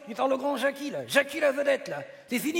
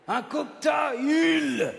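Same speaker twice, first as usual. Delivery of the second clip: very slow, drawn-out syllables, higher; exasperated repeat; 4 semitones higher, much slower than usual. Acted. Repeating the drink to a baffled partner, exasperated and drawn out. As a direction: stretch each syllable for someone not getting it.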